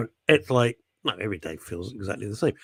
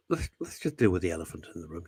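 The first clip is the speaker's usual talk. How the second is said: ordinary talk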